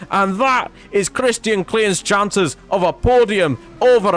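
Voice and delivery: put-on Scottish accent commentary, building to a big crash call; Scottish commentator. Commentating Klein's crash to viewers, excited Scottish accent. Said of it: "I don't know why I turned Scottish".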